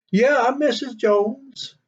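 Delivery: high, slow voice for the puzzled homeowner's wife; wife answering the door. Retold or imitated. Homeowner's wife answers the delivery driver at the door, high and slow. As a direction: lift into a high, drawn-out lady's voice.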